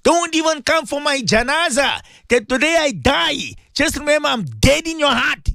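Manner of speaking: high, hurt mock rant at a relative; imitating a bitter grudge. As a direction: play the unforgiving relative, wounded and cold. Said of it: "We speak like that".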